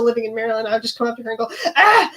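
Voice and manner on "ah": short high joke scream; random scream. Retold or imitated. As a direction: blurt a silly high yelp at a friend. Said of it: "Or scream".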